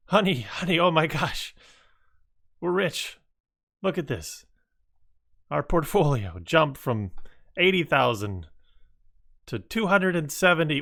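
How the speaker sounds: high, thrilled shout to the spouse; shrieking jackpot winner. Pretend. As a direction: squeal it like you just hit the jackpot. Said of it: "You may see something like this".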